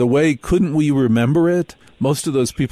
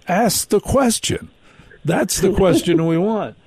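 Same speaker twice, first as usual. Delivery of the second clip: excited demand; 8 semitones higher than usual. Retold or imitated. Retold demand to experts.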